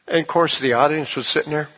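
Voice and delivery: ordinary delivery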